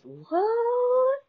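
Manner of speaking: a very high, stretched-out squeaked "What?"; shocked squeak. Retold or imitated. Hearing mom's boyfriend hit on her, squeaked high "What?". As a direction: let it fly up in pure disbelief. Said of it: "Like, the man is".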